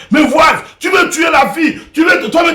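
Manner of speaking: accusing neighbours. Acted. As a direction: neighbours yelling at the husband. (voisins qui accusent en criant, plus aigu)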